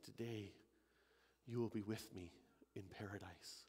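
low, very soft dying promise; Jesus speaking softly. Acted. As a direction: promise it faintly, with the last breath. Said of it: "And he said".

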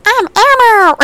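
high, loud puppet voice; Elmo. Acted. Copying brother's puppet impression, high and loud. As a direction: squeal it in a bad puppet impression. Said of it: "the best Elmo impression".

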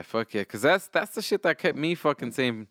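usual speaking voice

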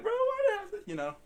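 very high, whiny pretend sob; hammed-up choked-up outburst; mock crying. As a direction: wail it like a fake breakdown. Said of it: "I'm gonna cry on the podcast".

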